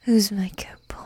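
ordinary talk, nothing marked

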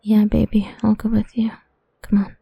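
usual speaking voice

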